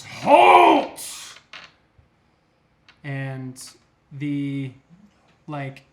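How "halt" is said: huge shouted command, much higher and louder; booming halt. Acted. Booming voice halts the fighting party, a huge shout. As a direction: bellow it to freeze the room. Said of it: "a booming voice that says".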